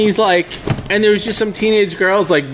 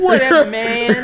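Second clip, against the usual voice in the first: mock dismissive; 7 semitones higher than usual. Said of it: "I was like".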